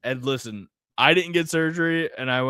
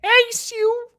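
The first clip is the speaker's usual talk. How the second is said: high greeting; 5 dB louder than usual. Acted. Joe Pesci bit.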